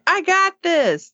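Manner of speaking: high-pitched, drawn-out eager boast; mock confidence. Teen offers his own wish to help, imitated cocky eagerness. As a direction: imitate an overeager volunteer. Mike's overconfident offer, acted out.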